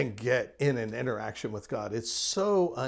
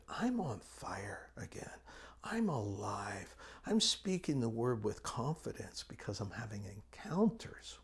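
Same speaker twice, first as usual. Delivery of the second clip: hushed retold whisper, much softer; 4 semitones lower, 9 dB quieter than usual. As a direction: whisper his revival like a secret. Retelling a revived pastor's words.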